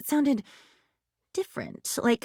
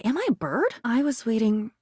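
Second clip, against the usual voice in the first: ordinary talk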